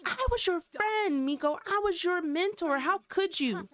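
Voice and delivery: hammed-up hurt accusation; betrayed Nikki. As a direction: plead as a betrayed friend, over the top. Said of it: "Nikki was like".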